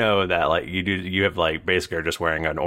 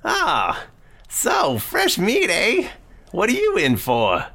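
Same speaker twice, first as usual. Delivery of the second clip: high sneering lizard prisoner voice; weaselly dragonborn; 3 dB louder than usual. Acted. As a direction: feral wasteland raider leering at fresh meat. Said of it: "He goes".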